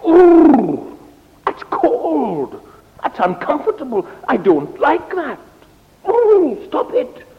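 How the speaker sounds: high-pitched squealing protest; complaining fire. Acted. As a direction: object like someone hit by a cold draught. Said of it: "it was complaining".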